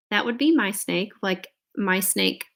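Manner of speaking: ordinary delivery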